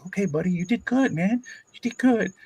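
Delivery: put-on high, gentle encouragement to a crying kid; reassuring coach. Coach consoling a crying boy, high gentle voice. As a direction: soothe the kid, voice lifted high. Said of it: "I said".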